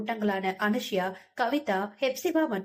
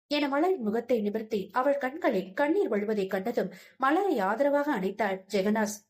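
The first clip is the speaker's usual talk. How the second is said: ordinary delivery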